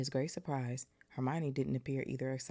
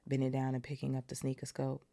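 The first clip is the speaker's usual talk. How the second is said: usual speaking voice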